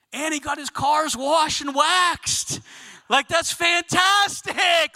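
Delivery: high, drawn-out excited shout; thrilled amazement. Acted. Acting viewers' escalating delight.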